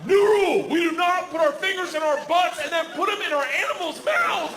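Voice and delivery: high appalled dad; stern rule. Retold or imitated. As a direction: lay down the law, appalled. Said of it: "dad laying down a rule".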